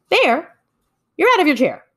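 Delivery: high loud amazed call; surprised call. Acted. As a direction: call out in disbelief. Hare calls out surprised.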